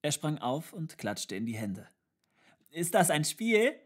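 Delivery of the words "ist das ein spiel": put-on high, loud, delighted child's question; excited cry. A narrator's reading. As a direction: call out like a laughing child. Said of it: "rief er". (aufgeregt gerufene, hohe Kinderfrage)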